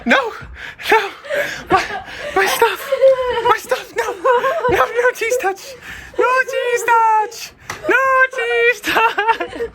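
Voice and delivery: high squealing laugh-cry. Real, not acted. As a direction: wail it through laughter. Laugh-crying at prank.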